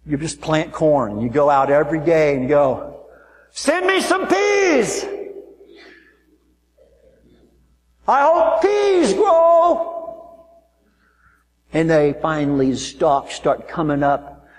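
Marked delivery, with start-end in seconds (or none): 3.5-9.9 high, slow hammed-up shout to the sky; mock desperate plea. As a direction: beg the sky like a foolish farmer. Mock gardener begging for peas.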